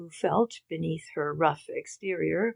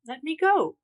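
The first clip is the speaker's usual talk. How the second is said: higher, louder scream to be let go; 9 semitones higher, 7 dB louder than usual. A narrator's reading. Girl resisting being taken to her room, screaming. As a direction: scream it out in panic. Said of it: "she screamed".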